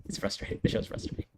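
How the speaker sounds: breathy, whispered under a sigh; whispered frustration. Real, not acted. Reviewer deflated by the show, whispered sigh. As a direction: sigh the words out, barely voiced.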